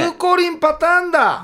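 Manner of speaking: high-pitched excited exclamation; excited breakthrough. Real, not acted. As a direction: blurt out the sudden realization.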